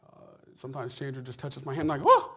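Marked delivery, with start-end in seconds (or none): 2.0-2.3 sudden high yelp of surprise; squealed ticklish whoa; startled whoa. Retold or imitated. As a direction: jump at the touch, laughing. Reacting to being touched.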